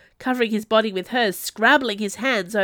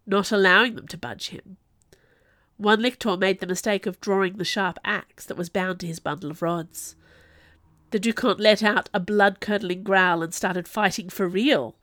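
usual speaking voice

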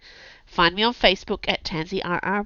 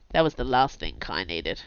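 usual speaking voice

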